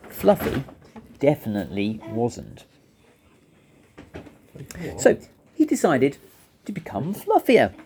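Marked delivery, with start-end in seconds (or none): none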